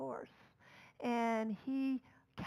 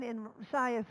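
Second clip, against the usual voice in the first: normal talking voice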